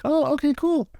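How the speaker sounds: very high, happy dog voice. Retold or imitated. The dog answers its owner, very high and happy. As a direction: squeak it out like a relieved puppy. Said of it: "He's like".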